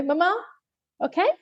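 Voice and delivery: put-on high little-child voice; lightly voiced toddler. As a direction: imitate a small daughter checking on mum. Toddler at shower glass.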